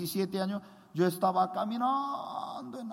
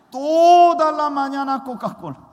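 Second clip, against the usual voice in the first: exasperated shout; 5 semitones higher, 11 dB louder than usual. Real, not acted. Preacher telling the congregation the morning's absurdity, exasperated shout. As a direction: blurt it out fed up.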